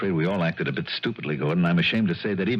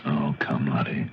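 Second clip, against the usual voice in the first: low hushed scoff; whispered; 7 semitones lower than usual. Acted. Anton gently doubting Lottie, whispering. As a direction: brush it off under the breath.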